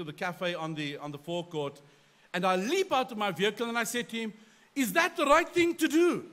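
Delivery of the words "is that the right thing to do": loud, high, demanding challenge; angry confrontation. Retold or imitated. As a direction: confront the driver sharply. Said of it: "That was my tone".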